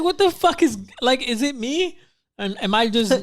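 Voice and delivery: high, squeaky confused voice; imitating confused disbelief. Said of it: "I was like".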